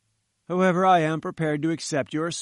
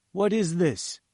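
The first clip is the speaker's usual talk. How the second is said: ordinary delivery, nothing marked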